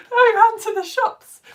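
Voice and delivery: high loud laugh into the line. Real, not acted. Host laughing at Bella's egg fried rice story. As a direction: crack up at the story.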